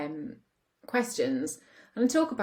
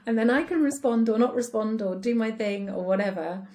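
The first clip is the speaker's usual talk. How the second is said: quoting husband firmly; 5 semitones higher, 4 dB louder than usual. Retold or imitated. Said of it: "He says".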